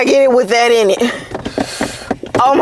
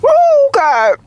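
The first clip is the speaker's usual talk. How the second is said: exclaiming. Real, not acted. Reacting to the heat.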